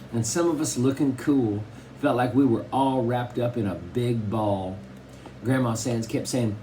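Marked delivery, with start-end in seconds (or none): none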